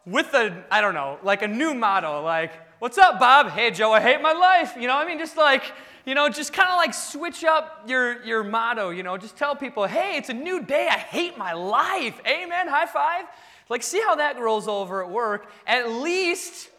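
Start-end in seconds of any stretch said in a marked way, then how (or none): 2.8-4.8 loud, high, upbeat hail to coworkers; mock-cheery greeting. Acting out new motto greeting coworkers. 9.9-13.3 higher-pitched, chipper proclamation; mock-cheery announcement. Acting out cheerful new motto.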